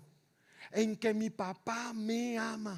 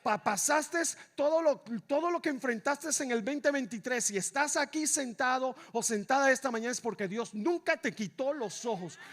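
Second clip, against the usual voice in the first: ordinary talk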